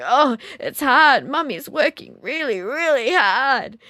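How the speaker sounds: high, loud, slow hammed-up effort; exaggerated struggling. Mother clambering beside toddler, hammed-up high loud straining. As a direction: ham up the struggle for the child. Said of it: "making these exaggerated struggling sounds".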